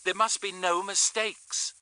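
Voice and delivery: urgent radio voice. A narrator's reading. Astrid warning Tony, urgent radio voice. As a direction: command it firmly over a crackling radio. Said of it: "crackled urgently from the receiver".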